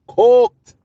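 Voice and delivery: loud, high gloating cry of "Cooked!"; triumphant shout. Real, not acted. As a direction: bellow it like a victory call. Streamer gloats over the debate.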